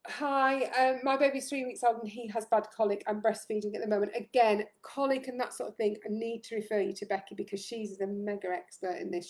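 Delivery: normal talking voice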